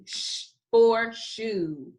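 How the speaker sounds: slow, sh held long before shoe. Shown as a demonstration. Teacher modelling the sound slowly for the class. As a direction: stretch the sh in shoe. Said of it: "Say it with me".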